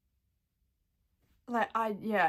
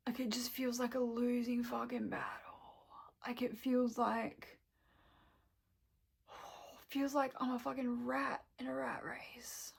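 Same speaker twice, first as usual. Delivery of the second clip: whispered and much softer, defeated; weary whisper; 6 dB quieter than usual. Real, not acted. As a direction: sigh it out, worn down. Weary despair about the world.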